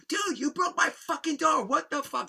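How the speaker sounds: very high, strained mock yelling; imitated pissed-off yell. Homeowner yelling about his broken door, high furious imitation. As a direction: mimic a friend's outraged outburst. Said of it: "He was so pissed".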